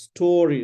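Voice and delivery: STORY with a long, drawn-out AW vowel; correct pronunciation. Shown as a demonstration. Teacher shows the student the word, careful pronunciation. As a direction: lengthen the first vowel of STORY. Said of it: "Look at this".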